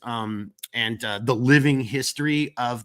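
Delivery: ordinary delivery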